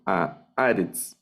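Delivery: a Aretz slow, stress on A of Aretz; acento en la primera A. Shown as a demonstration. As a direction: draw out a Aretz, falling after the stressed A. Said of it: "aquí va el acento". (a Aretz lento, con acento en la A de Aretz)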